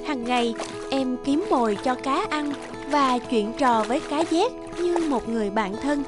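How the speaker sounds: plain reading voice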